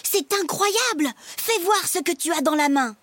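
high, excited wonder; amazed little brother. Acted. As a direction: burst out like an eager kid. Brother amazed, begs to see.

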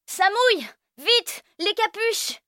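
put-on very high urgent kid call; crying out. Nico calling out in the rain, very high. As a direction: shout a warning. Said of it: "Nico crie".

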